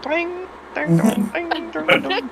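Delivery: high, soft twanging noise; mock banjo twang sound; imitated twang. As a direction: pluck the tongue like a banjo string, high and thin. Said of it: "he just starts twanging on it".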